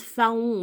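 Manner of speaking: "faon" en deux syllabes, fa-on; prononciation fautive. Shown as a demonstration. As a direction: say "faon" as fa-on, sounding the O. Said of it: "on dit pas faon". (« faon » prononcé fa-on, à tort)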